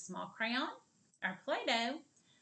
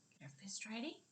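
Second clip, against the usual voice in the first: ordinary delivery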